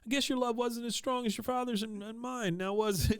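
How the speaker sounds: high mock-motherly needling; bad Peggy. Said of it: "here is the one that enforces the bad Peggy".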